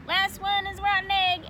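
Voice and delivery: loud, high playground dare; yelling. Acted. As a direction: yell it racing off. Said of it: "Max yelled".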